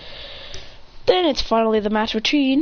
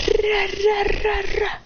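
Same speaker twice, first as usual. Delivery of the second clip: ra ra yelled high and quick; high shouted ra ra; high yelled battle grunts; 10 semitones higher than usual. Acted. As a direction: grunt like throwing a flurry. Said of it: "He goes".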